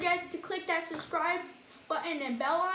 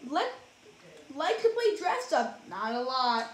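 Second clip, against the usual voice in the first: lively, sing-song storybook reading; reading to children; 4 dB louder than usual. A narrator's reading. Reading a rhyming picture book to kids, animated. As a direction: read it like bedtime for little ones.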